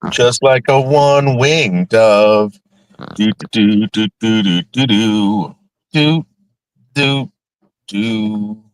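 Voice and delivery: dreamy stoned doo-doo humming. Real, not acted. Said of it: "Sorry, I'm really stoned".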